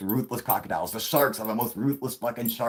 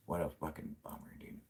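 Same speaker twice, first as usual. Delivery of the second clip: whispered sympathy; 4 semitones lower, 14 dB quieter than usual. Real, not acted. Grieving with Tony.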